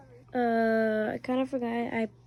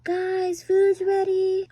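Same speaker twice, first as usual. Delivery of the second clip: high, slow, loud bark; calling kids to dinner; 8 semitones higher, 9 dB louder, much slower than usual. Pretend. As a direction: bark the call across the house. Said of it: "bark and, and kind of coughed".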